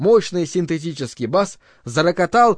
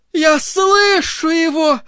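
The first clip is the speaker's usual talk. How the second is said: much higher, slow ecstatic cry; 11 semitones higher, 4 dB louder, slower than usual. Acted. Morgana leaps up in ecstasy, crying out. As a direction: shout it in rapture. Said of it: "закричала она".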